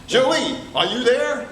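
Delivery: calling out. Acted. Pierre calls at door.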